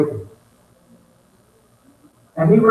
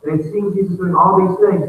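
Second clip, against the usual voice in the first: normal talking voice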